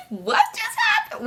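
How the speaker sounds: high squeaky disbelieving laugh; bewildered laugh. Retold or imitated. She laughs in shock after the fight. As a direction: laugh in total confusion, voice up high.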